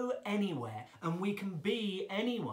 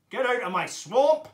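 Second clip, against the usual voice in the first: very loud, higher ogre roar; Shrek; 5 semitones higher, 14 dB louder than usual. Shown as a demonstration. Ogre driving someone off the swamp, full shout. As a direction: bellow it, rising on the last word. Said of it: "Can you do a Shrek impression?".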